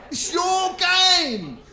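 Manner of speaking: bigger shout. Acted. Comedian repeating it to the crowd, slow high shout. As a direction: stretch it out like a frustrated yell.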